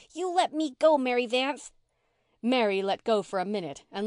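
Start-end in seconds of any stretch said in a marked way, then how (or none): 0.1-1.7 high, loud scared protest; scared child standing firm; frightened but staunch. A narrator's reading. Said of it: "frightened but staunch".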